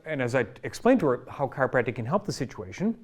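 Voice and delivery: normal talking voice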